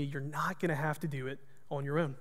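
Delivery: soft whisper. Retold or imitated. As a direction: say it softly like a voice from God. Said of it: "the Lord whispered to me".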